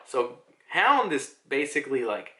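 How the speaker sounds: normal talking voice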